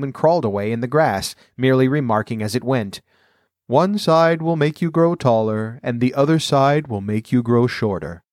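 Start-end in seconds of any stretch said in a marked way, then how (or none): 3.7-8.2 caterpillar voice. A narrator's reading.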